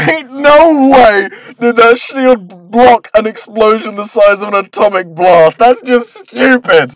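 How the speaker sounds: loud, high, drawn-out incredulous outburst; indignant disbelief. Acted. Narrator scoffs at an impossible shield block, indignant disbelief. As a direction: protest it, stretching the stressed words.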